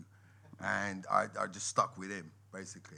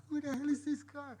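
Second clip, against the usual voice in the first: stunned disbelief. Retold or imitated. Retold shock at the Roxy.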